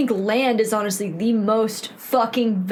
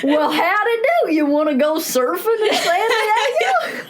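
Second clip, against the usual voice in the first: Southern twang voice, teasing the friend; 7 semitones higher than usual. Pretend. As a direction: play a yokel from the South, pitched up. Mock country hick voice.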